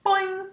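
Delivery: high springy boing; boing with joy; happy frog boing; joyful boing. Acted. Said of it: "Og jumped for joy".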